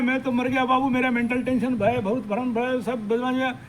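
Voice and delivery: high, loud mock lament of a troubled man; mock wailing. Guru imitating a frightened follower, mock wailing. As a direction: whine it like someone sure they are ruined. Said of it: "जब सब सो के रो रहे हैं".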